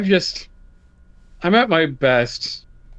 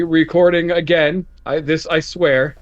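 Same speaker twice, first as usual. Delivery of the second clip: ordinary talk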